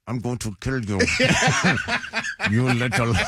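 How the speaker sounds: put-on teeth-gritted dad threat in Cuban accent. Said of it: "he had a Cuban accent".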